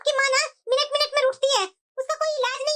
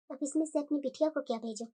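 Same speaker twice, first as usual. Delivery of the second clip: much lower, much softer private mutter; soft muttering to self; 13 semitones lower, 13 dB quieter than usual. Acted. Mother-in-law secretly sorting gifts for her daughter, soft mutter. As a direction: murmur it so nobody hears.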